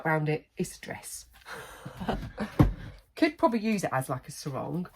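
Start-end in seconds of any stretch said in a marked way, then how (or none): 0.6-2.2 whispered joke. Real, not acted. Joking tablecloth dress.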